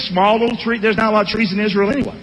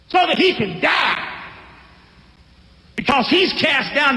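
higher, shouted demand to hand him over; mock angry mob; 5 semitones higher than usual. Pastor voicing townsmen shouting at Gideon's father, higher. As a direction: yell it like a lynch mob.

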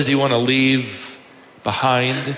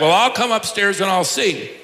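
higher-pitched call at ordinary volume; mild mock holler back; 6 semitones higher than usual. Sister in the kitchen answering upstairs, mildly hollered. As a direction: imitate calling upstairs, without shouting. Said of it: "and she hollered back".

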